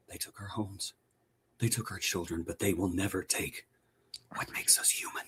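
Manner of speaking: hushed, soft, dramatic movie whisper; solemn whisper. Pretend. Parodying the show's solemn rebels in a hushed whisper. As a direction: breathe it out like a grim rebel staring into the distance. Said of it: "look into the distance and whisper".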